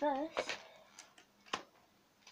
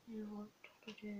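whispering; 7 dB quieter, much faster than usual. Pretend. ASMR librarian roleplay.

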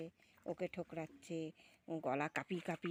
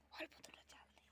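hushed unvoiced aside; whisper; 15 dB quieter than usual. Real, not acted. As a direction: whisper it so the ducks don't notice. Aside to son while filming. (ফিসফিস করে বলা)

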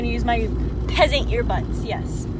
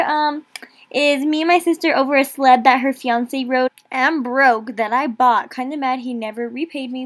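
ordinary talk, nothing marked

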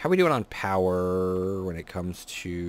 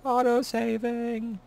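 much higher, slow and drawn out; high sing-song; slower than usual. Pretend. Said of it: "Oh, auto-saving".

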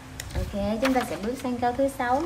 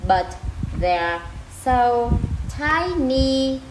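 word by word; 3 dB louder, much slower than usual. Shown as a demonstration. Teacher breaks the sentence up for learners, word by word. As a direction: separate words with a pause after each. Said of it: "đọc lại từng từ".

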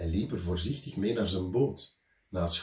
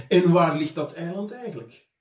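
red-faced teacher; 6 semitones higher, 7 dB louder than usual. A narrator's reading. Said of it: "Hij werd zo rood als een kreeft".

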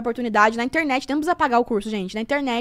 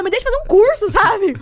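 mock exasperated protest; 7 semitones higher, 5 dB louder than usual. Mock protest against judgers.

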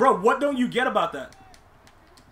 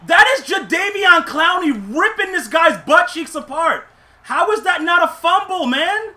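higher, louder, worked-up ranting; excited rant; 6 semitones higher, 6 dB louder than usual. Real, not acted. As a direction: rant in amazement at the hit. Ranting excitedly about the play.

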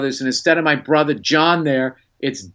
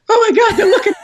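high, loud panicked squeal; stage fright squeal; 6 dB louder, faster than usual. Retold or imitated. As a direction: freak out as the stars turn to you. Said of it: "I was just like".

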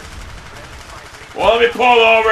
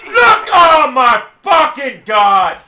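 exasperated shout; 3 semitones higher, 3 dB louder, slower than usual. Real, not acted. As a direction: yell in real gamer outrage. Player outraged at the game.

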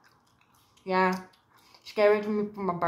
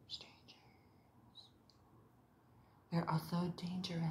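whisper; 3 semitones lower, 13 dB quieter than usual. Acted.

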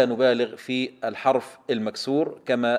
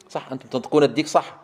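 normal talking voice

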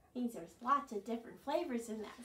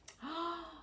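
soft gasp; 6 semitones higher, 5 dB quieter than usual. Real, not acted. Storyteller gasps at the worm.

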